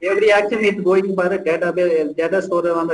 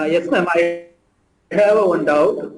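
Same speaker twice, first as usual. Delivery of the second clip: feeble voice on a call. Real, not acted. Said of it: "your voice is feeble".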